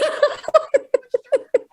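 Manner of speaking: bursts of high laughter. Real, not acted. Cracking up while retelling the weigh-in, high laughter. Laughing at own retold outburst.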